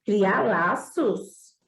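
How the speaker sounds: very slow, musing repeat; slow wondering echo. Acted. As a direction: repeat it slowly, taking it in. Prince echoes the fox. (repetição muito lenta e pensativa)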